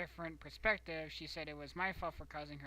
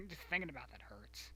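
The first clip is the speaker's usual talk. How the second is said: ordinary talk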